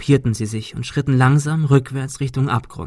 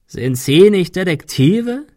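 slow, raised croaky question; croaking; 7 semitones higher, 5 dB louder, slower than usual. A narrator's reading. Gunman taunts the detectives, croaking. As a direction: croak it out mockingly, rising at the end. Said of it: "krächzte er".

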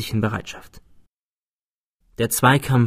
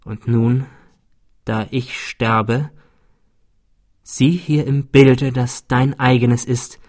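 dying man's last words. Acted. Dying rival speaks last words.

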